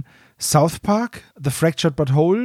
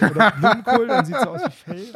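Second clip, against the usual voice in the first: laughing; 4 semitones higher, faster than usual. Real, not acted.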